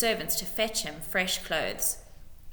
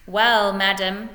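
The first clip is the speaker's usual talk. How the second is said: speaking carefully; 9 dB louder, slower than usual. A narrator's reading. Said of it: "speaking carefully".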